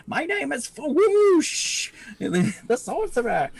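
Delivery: very high, slow, grand squeaky kobold; kobold sorcerer. Acted. Kobold sorcerer proclaiming his name to the party. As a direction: announce yourself like a tiny, pompous kobold. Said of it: "if I'm playing the kobold, uh, sorcerer".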